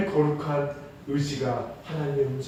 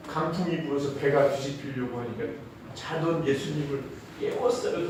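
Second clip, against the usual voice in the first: normal talking voice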